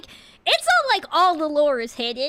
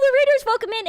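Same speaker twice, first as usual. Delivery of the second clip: usual speaking voice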